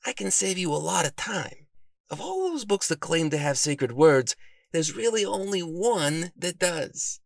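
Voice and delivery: high-pitched bookish character voice; reassuring bookworm. A narrator's reading. Bookworm offers to save the detective time, reassuring.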